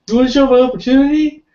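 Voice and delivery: high, loud, fast mock pitch voice; cartoonish high hard-sell imitation; imitating Eli's sales voice. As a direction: ham up a squeaky, overeager salesman. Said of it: "his voice was like that".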